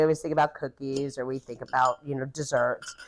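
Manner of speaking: a quick whispered aside; whispering. Real, not acted. Aside about typical pairings.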